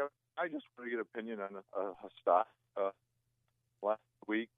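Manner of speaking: breaking up on a bad phone line; faint, cutting in and out; barely audible. Real, not acted. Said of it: "I can barely hear you".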